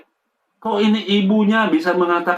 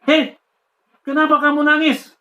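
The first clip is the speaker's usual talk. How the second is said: father's sharp question; 10 semitones higher than usual. Retold or imitated. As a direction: snap the question at the boy. Father questions crying son.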